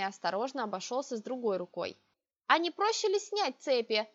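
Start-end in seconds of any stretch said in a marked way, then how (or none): 2.5-4.1 inquiring. A narrator's reading. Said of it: "поинтересовалась я".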